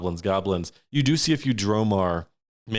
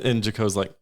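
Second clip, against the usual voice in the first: ordinary talk, nothing marked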